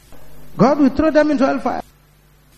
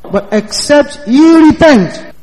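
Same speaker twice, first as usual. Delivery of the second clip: higher and louder, slow, "repent" hammered; loud scripture warning; 5 semitones higher, 6 dB louder, slower than usual. Acted. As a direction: boom out the warning slowly. Preacher quoting Jesus' warning.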